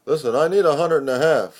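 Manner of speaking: lightly voiced pushy friend, quick; voiced friend asking for money. Friend asks narrator for money.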